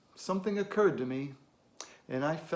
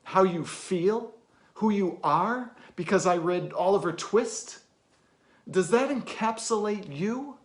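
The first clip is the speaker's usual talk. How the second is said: louder, higher, pointed rhetorical questions; heated challenge; 4 semitones higher, 8 dB louder than usual. Acted. Challenging an orphan who presumed to know him, heated. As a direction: fire each question at them, building in force.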